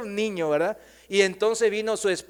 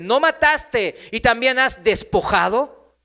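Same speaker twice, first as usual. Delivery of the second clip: loud, slow prophetic declamation; full-force preaching; 4 semitones higher, 8 dB louder than usual. Acted. Prophet Elías delivers God's sentence to the king, declaimed loudly. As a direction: thunder the verdict out slowly.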